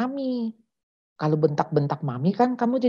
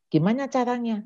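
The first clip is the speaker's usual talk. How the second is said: ordinary talk, nothing marked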